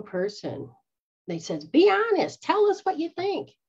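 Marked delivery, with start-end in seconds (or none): 1.7-3.6 high, bright mock coaxing voice; mock sweet invitation. Mocking the group who asked for honesty. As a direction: pitch it up like a sugary invitation.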